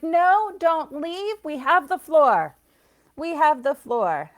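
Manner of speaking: hammed-up ghosts refusing to let go; spirits insisting. The family spirits hold the floor, loud and pleading. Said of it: "They're like".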